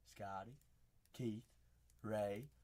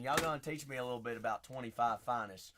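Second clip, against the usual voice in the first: usual speaking voice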